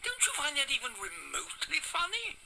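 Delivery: exasperated snap back; irritated retort. Acted. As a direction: drag it out in annoyance. Irritated retort to repeated word.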